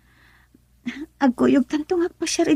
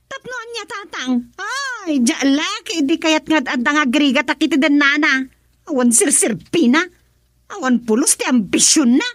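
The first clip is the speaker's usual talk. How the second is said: upset protest; 6 semitones higher than usual. Acted. Daughter protests against father.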